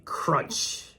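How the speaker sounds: ordinary delivery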